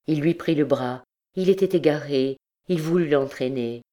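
ordinary delivery, nothing marked

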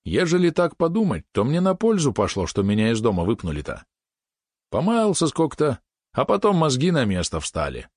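high-starting, musing country lad; lightly voiced reflective peasant. Lad decides being thrown out did him good, reflective. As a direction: muse aloud with a hint of rustic lilt. Prokhorov's lines voiced in narration.

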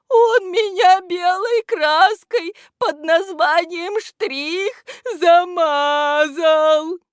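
loud, high, drawn-out tearful wail; плач. A narrator's reading. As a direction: complain in a sobbing wail. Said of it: "в голосе у этого невидимого слышались слёзы". (громко и жалобно, с плачем)

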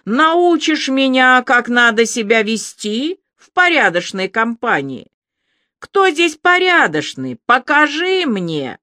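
a ringing, overwrought challenge; ringing strained voice. A narrator's reading. Alya mocks Ilya in front of strangers, high overwrought ringing voice. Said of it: "как надрывно звенит её голос".

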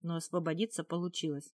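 ordinary delivery

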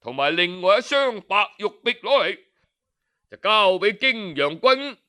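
plain reading voice

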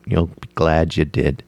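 ordinary delivery

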